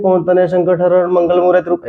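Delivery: verse rattled off fast with no pauses; wrong way to read a couplet, all in one breath; rushed reading. Shown as a demonstration. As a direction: run the line straight through, fast and flat. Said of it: "ऐसे तो पढ़ते नहीं है".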